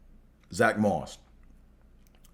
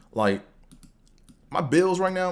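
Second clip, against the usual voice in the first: usual speaking voice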